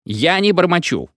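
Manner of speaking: high, mildly indignant retort; shouting back. A narrator's reading. Orator answers his heckler, mild shout back. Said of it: "закричал в ответ оратор".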